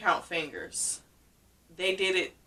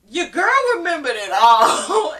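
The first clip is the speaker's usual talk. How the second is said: laughing; 8 semitones higher, 9 dB louder than usual. Real, not acted. Laughing at her own story.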